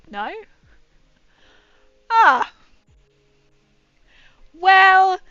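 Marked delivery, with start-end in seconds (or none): 2.1-5.2 loud, high, drawn-out Ah; loud announcement. Real, not acted. Streamer to chat, loudly announcing an update.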